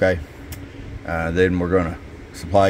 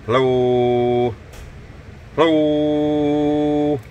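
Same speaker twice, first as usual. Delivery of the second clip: long, stretched hello keyed into the mic, higher; drawn-out radio hello; 8 semitones higher, 3 dB louder, much slower than usual. Acted. CB tech testing the mic for Kurt, long drawn-out hello. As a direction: draw the hello out like a radio test call.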